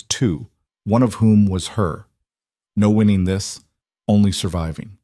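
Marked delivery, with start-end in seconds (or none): none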